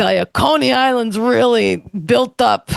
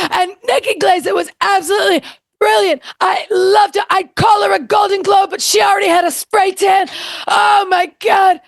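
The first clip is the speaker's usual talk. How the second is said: Joan Rivers impression; 5 semitones higher than usual. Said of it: "Joan Rivers on Nikki Glazer's performance".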